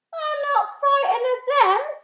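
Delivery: very high, loud boast; defiant shout. Acted. Centipede to the earthworm, high defiant shout. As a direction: shout back bravely. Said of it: "shouted the centipede".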